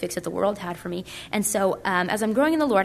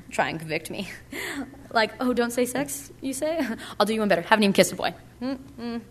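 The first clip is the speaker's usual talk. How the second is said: mock-cocky teen swagger, pitched up; cocky attitude; 3 semitones higher than usual. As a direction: dare them to find fault, half joking. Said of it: "that's the kind of the attitude I went around with".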